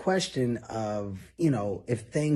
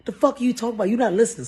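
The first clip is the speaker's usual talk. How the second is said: higher and louder, arguing hard; heated argument; 9 semitones higher, 5 dB louder, much faster than usual. Retold or imitated. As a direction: yell back at a drunk friend. Drunk argument on plane.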